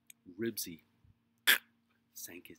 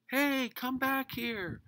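high, slow, loud shout; 13 dB louder, slower than usual. A narrator's reading. Robert running after the dog, drawn-out shout. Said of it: "shouted Robert".

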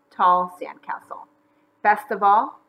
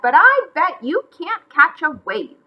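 high, loud, heated dare; angry challenge; 8 semitones higher, 7 dB louder than usual. A narrator's reading. McGreely daring bunnies to surf, angry cry. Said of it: "he cried".

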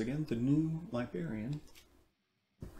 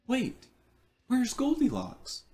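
put-on eager children; 9 semitones higher, 3 dB louder than usual. A narrator's reading. Children question Baby Bear.

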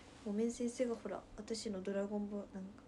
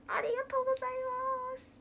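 高い声でお礼の物真似; 本人風に高くお礼を言う; 尾上瑞稀のモノマネ; 13 semitones higher, 4 dB louder, much faster than usual. Pretend. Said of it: "尾上瑞稀のモノマネ". (高い声で尾上瑞稀風のお礼)

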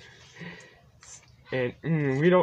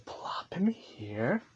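slow unvoiced whisper; 6 semitones lower, 12 dB quieter, much slower than usual. Real, not acted. Placing Percy down quietly, slow whisper. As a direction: breathe it out, barely audible.

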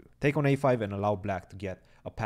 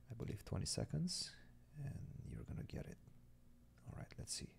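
ASMR voice; 5 semitones higher, 14 dB quieter than usual. Real, not acted. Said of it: "My A S M R voice".

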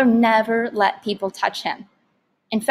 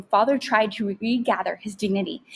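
ordinary delivery

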